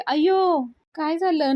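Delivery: high, loud startled cry; alarmed gasp. Retold or imitated. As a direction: jump at the bad news. Payal alarmed at the news. (घाबरून, मोठ्याने आश्चर्याचा उद्गार)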